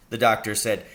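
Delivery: plain reading voice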